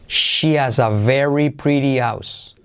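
slow, clear full sentence; slow model sentence. Shown as a demonstration. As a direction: read it slowly to copy. (frase completa despacio)

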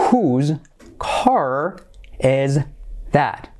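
each word alone with a pause after; slow word-by-word. Shown as a demonstration. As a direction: dictate it one word at a time. Slow model, then natural take. (palabra por palabra, con pausas)

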